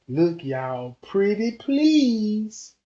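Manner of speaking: slow, stretched-out plea; retold appeal, slow and emphatic; begging. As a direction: draw out "pretty please" as you beg. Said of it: "When I beg everybody and say".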